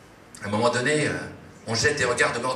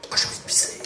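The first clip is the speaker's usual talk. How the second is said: breathless whisper, desperate to pee; whispered urgency; whispered aside; faster than usual. Acted. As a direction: hush it out, squirming.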